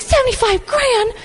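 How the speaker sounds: mock disbelief, pitched high; incredulous. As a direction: jump way up in disbelief. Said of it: "most people would be like".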